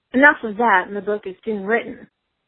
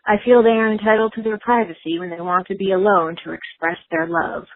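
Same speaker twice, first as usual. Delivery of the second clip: ordinary talk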